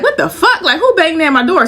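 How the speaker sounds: retold alarmed what-the-fuck; startled outburst. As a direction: pitch jumping way up in alarm. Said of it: "I get up, I'm like".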